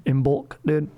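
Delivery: a weary, amused sigh into Imbolc. Real, not acted. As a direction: breathe out Imbolc as if the name is absurd. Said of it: "Even saying these words sounds crazy".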